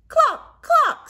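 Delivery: very high, loud hen cluck; clucking cow. Acted. Teacher voicing Cow for her class, high loud clucking. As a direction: cluck like a chicken. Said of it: "said Cow".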